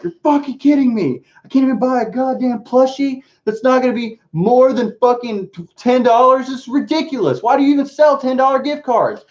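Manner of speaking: high-pitched mock outrage, hammed up; incredulous rant. Retelling the Best Buy checkout, high mock outrage. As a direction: squeal it out like you can't believe the price. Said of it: "And I was like".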